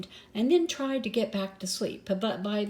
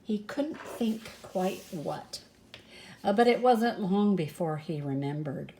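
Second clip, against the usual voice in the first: ordinary delivery, nothing marked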